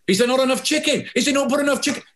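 panicked waiter. Acted. Waiter panics over the soup.

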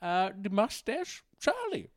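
lightly voiced accented dictator dishing out fashion advice; Mister Addy. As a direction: play a chatty accented dictator on a fashion show.

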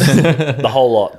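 ordinary talk

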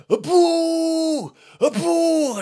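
long, high, loud mock boo; crowd booing. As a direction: howl a drawn-out boo like a hostile audience. Imitates crowd booing the act.

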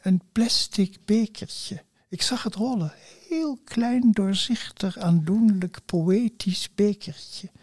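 slow, savoured word by word, with pauses; mock-poetic. Real, not acted. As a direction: tenderly dwell on each adjective as if reciting a little poem. Mock-poetic ode to a thrown cup. (quasi-poëtisch, langzaam en vertederd)

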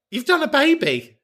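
very high, squealed amazement; high-pitched mock-incredulous exclamation; comic indignation. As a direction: squeal it as if the fact settles everything. Indignant on caller's behalf.

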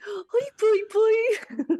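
high, cooing pet voice; baby talk to cat. Shown as a demonstration. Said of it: "like that".

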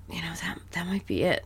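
hushed unvoiced whisper. Real, not acted. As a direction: breathe it like a secret.

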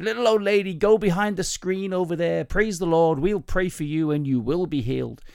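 put-on American-accented healing minister; slight American accent. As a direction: call it out like an American preacher. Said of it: "that's a slight American accent".

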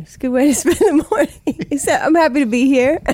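laughing. Real, not acted. Laughing through her words.

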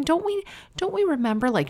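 normal talking voice

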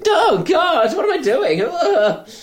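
high, loud hammed-up despair; mock panic. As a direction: wail it like a comic meltdown. Acting out waves of panic.

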